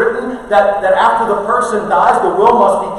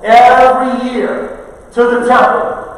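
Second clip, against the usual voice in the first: building from raised to shouting; preaching crescendo; 4 semitones higher, 6 dB louder than usual. Real, not acted. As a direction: preach it home, louder and louder.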